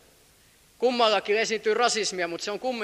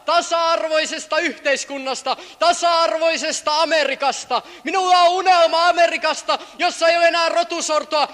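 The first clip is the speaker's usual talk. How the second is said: loud, higher rally oratory; orator's speech; 9 semitones higher, 7 dB louder than usual. Retold or imitated. Preacher reenacting King's dream speech, loud oratory. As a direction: proclaim the dream to a crowd. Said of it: "Hän sanoi".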